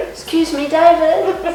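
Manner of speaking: re-enacted high-pitched shout to a celebrity; calling out. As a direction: hail someone walking past. Calling out to passing Beckham.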